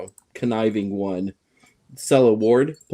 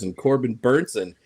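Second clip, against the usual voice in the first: normal talking voice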